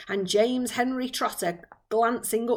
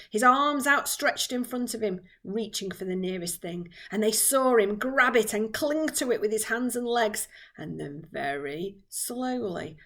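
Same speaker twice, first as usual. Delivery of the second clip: plain reading voice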